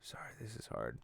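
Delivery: mock tearful whisper. Host fakes being overcome while reading news, whispering. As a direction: pretend the news is too much to bear.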